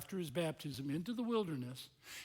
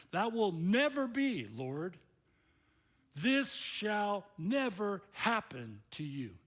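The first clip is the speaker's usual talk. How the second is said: louder, slow insistence on never; forceful protest; 6 semitones higher, 5 dB louder, slower than usual. Acted. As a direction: dig in and refuse to accept it. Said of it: "He's a little stronger".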